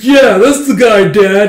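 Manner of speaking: loud, high, drawn-out acted cry; excited shout. Son points out the guy to his dad, excited shout.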